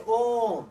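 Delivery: ओम loud on one long-held ओ; prolonged vowel. Shown as a demonstration. Teacher demonstrating प्लुत स्वर, ओम loud and drawn out. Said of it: "तीन मात्रा का समय लगता है".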